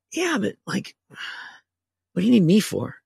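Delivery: high, slow, bemused editor voice; puzzled editor. Retold or imitated. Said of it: "he's like".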